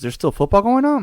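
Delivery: wife learns the Chiefs are in the playoffs, in high puzzled surprise; confused surprise. Retold or imitated. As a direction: imitate the wife baffled that the season isn't over. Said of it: "she was confused".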